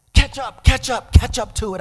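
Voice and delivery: high, loud urging at the driver; excited cry to the driver to speed up; urgent shouting. Retold or imitated. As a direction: push him to chase it down, loud. Urging driver to chase object.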